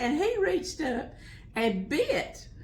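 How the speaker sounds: usual speaking voice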